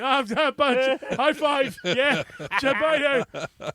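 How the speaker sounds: high, flustered brush-off as she leans in for a kiss; awkward high goodbye. Retold or imitated. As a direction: dodge the kiss with a squeaky, laughing bye. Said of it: "I'm like".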